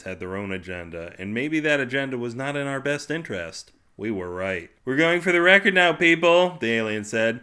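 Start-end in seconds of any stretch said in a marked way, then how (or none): 4.9-6.6 loud, high, showman call to the crowd; rally them like a game host; gleeful announcement. Acted. Alien addressing seventeen billion people, loud gleeful call.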